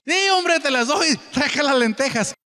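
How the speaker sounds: high, eager, careless give-in; hungry Esaú giving in. Acted. Preacher voicing hungry Esaú handing over his birthright, high and eager. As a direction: toss away the birthright without a thought.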